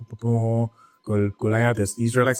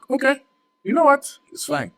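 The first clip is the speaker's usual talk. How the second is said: much higher, mock-resigned king voice; hammed-up king who gives in with a shrug; 5 dB louder than usual.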